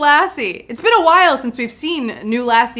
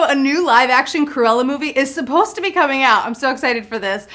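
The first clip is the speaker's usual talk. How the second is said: ordinary talk, nothing marked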